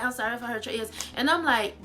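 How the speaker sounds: normal talking voice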